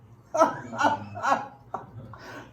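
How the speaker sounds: high burst of laughter; a big laugh at your own remark. Real, not acted. Laughs at own mock objection.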